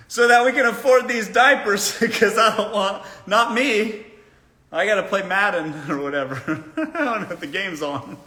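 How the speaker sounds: lightly voiced lazy husband, breaking into laughter; half-laughing mock of a shirking man; mock deadbeat husband.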